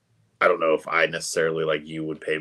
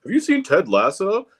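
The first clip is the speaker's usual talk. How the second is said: high mocking voice; 11 semitones higher, 3 dB louder than usual. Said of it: "people that are like".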